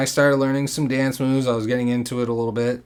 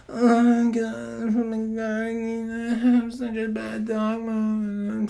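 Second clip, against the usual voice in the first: put-on much higher, soft drunk whimper; mock whimper; 10 semitones higher, 4 dB quieter than usual. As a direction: whimper it pitifully. Imitating drunk whining.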